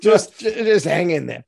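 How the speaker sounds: loud, high, fast hammed-up Boston accent. Advisor telling the governor to hang in, in a loud Boston accent. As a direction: pump him up with a loud Boston twang. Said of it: "Your impression".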